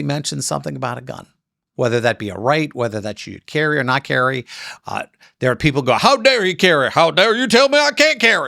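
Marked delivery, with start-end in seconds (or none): 5.9-8.5 mock an indignant Southern gun owner; Southern accent. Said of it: "I said that with a Southern accent".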